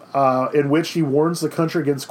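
ordinary talk, nothing marked

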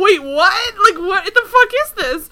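high, loud incredulous outburst; laughing disbelief. Real, not acted. Podcaster reacting to the boyfriend's sudden accusation, laughing disbelief. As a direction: exclaim in baffled disbelief, half laughing.

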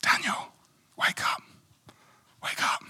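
whispered wake up in the dark; father's night whisper. Retold or imitated. Father wakes young Daniel at night, whispering. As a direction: whisper to wake a sleeping boy. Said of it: "being woken up by my father".

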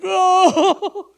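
high, loud overcome gasp of an oh; overwhelmed cry. Retold or imitated. Preacher recalling the gift, overcome. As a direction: break into tearful disbelief. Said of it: "I'm like".

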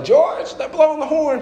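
high, urgent mom calling out; mom's urgent voice. Retold or imitated. Wife urging her husband at the parking lot, high and urgent. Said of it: "Mom's like".

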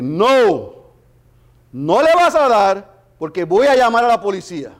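loud, firm warning shout. Retold or imitated. Preacher retells warning the attacker through the window, loud firm call. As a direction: stop him with your voice, loudly.

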